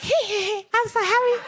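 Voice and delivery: high-pitched gleeful giggly pup; happy dog voice. Retold or imitated. Dog to worried family after being hit, gleefully happy. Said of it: "she's like".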